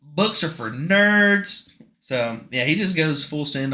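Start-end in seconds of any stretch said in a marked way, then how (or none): 0.0-1.7 loud, slow, smug hero brag; cocky Harry. Retold or imitated. Podcaster mocking Harry as a smug dunce, loud and slow. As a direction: drawl out Books like a proud dunce.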